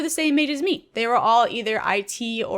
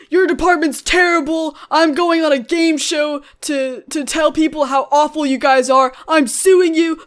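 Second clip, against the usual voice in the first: imitating a louder, higher furious yell; furious customer; 4 semitones higher, 7 dB louder than usual. Customer on the phone raging about his loaner PC, in a mock furious yell. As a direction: rant like an outraged caller. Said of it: "he freaked out on me".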